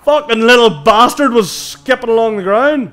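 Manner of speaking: high loud startled yell; startled shout. Real, not acted. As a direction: yell it in sudden fright. Startled outburst in horror game.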